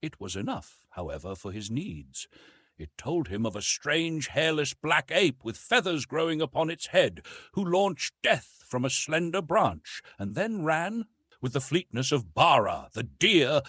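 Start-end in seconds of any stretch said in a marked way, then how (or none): none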